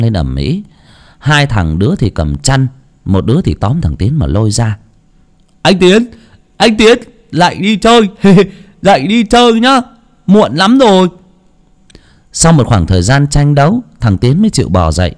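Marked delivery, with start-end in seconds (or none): 5.6-11.1 put-on excited kids calling. A narrator's reading. Children waking their cousin.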